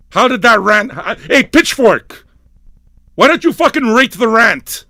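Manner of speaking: high, loud combative challenge; yelling. Real, not acted. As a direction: yell it out as a challenge. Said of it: "I love yelling".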